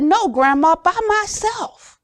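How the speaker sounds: put-on high, slowed child's voice; little granddaughter insisting. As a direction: play the small girl who wants to do it herself. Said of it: "she said".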